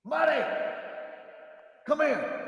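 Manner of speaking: calling the dog. Real, not acted. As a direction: call the dog from across the room.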